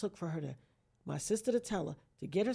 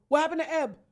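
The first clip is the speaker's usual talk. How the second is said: high, louder worried call; brother-in-law calling out; 8 semitones higher, 8 dB louder, faster than usual. Retold or imitated. Brother-in-law in the background asking about Eb, alarmed. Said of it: "in the background going".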